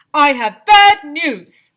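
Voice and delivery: slow, loud, high pronouncement; grave announcement. Acted. Mayor announcing bad news to the school, slow and loud. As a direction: announce it with weight. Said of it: "he announced".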